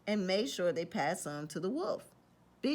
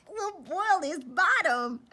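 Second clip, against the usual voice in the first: high, louder, slow gloating; gleeful pigs; 10 semitones higher, 6 dB louder, slower than usual. Acted. Pigs plotting against the wolf, gleefully. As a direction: gloat like little schemers. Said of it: "they chuckled".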